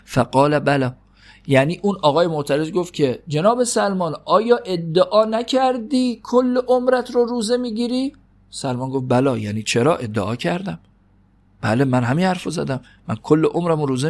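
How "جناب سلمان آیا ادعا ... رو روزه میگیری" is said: high, incredulous questioning voice; a mocking high question; mock challenge. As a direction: play the doubter, pitched way up in disbelief.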